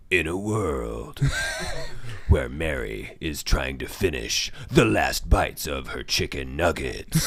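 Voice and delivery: lurid grindhouse trailer growl; slow, low trailer narration; movie announcer voice. Acted. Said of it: "movie announcer voice".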